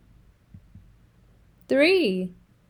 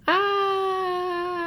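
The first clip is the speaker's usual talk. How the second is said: sudden high startled ah; startled cry; 7 semitones higher than usual. Acted. Elephant cries out at the bird landing on his head, startled. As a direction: yelp as something lands on your head.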